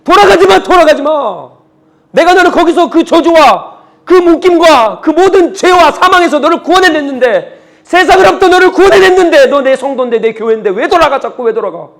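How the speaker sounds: high, loud cry of reproach, easing at the end; full-force pleading rebuke, subsiding on the last questions; God's impassioned plea. Acted. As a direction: cry out as God begging them not to turn back. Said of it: "하나님께서 또 말씀하시는 거예요".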